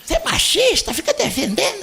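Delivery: mock accusation. Mocking argument between guests.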